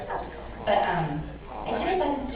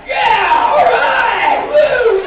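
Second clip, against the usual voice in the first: loud cheering whoop; excited whoop; 12 semitones higher, 12 dB louder, much slower than usual. Retold or imitated. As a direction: shout it out, pumped up. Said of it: "I kind of was like".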